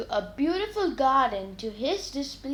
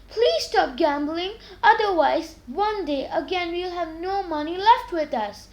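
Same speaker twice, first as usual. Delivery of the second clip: a touch higher, pleading warning to her husband; wife warning; 6 semitones higher, 5 dB louder than usual. A narrator's reading. Wife begging George to quit gambling, lightly pleading. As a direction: urge him to stop, a little louder. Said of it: "His wife warned him".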